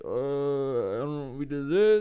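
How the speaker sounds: slow, hesitant put-on voice; clueless drunk husband. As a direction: imitate someone with no idea what's happening. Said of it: "he doesn't know anything".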